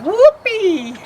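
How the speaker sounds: high, loud cowboy whoop; yelling whoopee. Acted. Buckaroos on horseback calling out, a loud happy whoop. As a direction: let out a joyful cowboy holler.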